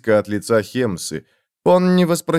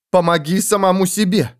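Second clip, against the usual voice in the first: angry cry, pitched up; angry shout; 7 semitones higher than usual. Acted. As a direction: snap it at him in anger. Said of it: "гневно крикнула она".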